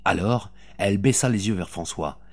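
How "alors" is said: ordinary delivery, nothing marked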